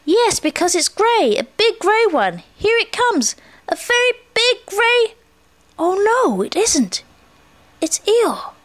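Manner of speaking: high, drawn-out excited voice; Pooh watching eagerly. A narrator's reading. Pooh sees Eeyore instead of a stick, excitement sinking. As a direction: rise with excitement, then drop in dismay.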